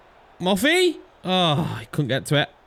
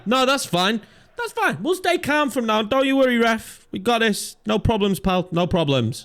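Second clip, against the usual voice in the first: sarcastic, pitched higher, sugary reassurance; mock calm; 7 semitones higher than usual. Gamer sarcastically reassuring the referee after a foul, mock calm. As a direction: pretend to be calm while seething.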